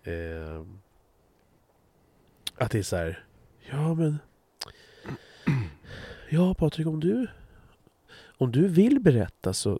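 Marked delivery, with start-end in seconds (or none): 3.7-9.8 higher, sugary mock-polite nudge; mock overly careful. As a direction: tiptoe around him like you fear offending. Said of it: "så himla så här försiktigt".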